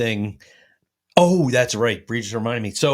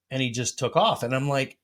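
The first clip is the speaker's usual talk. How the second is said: normal talking voice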